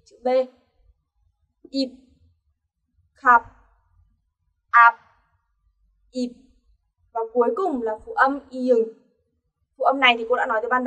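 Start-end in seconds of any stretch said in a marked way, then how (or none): 1.7-6.3 "Ịp, khap, áp" each shut on unreleased lips; clipped drill of "Ịp, khap, áp, ịp" ending in chữ B; final B. Shown as a demonstration. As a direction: stop "khap" and "áp" short with closed lips, no puff. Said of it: "đọc thành gì? Chữ B".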